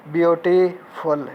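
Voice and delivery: "ब्यूटीफुल" slowly split as ब्यूटी, फुल; syllable breakdown. Shown as a demonstration. Teacher showing students two syllables, "ब्यूटीफुल" slowly split before फुल. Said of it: "एक है ब्यूटी, दूसरा फुल".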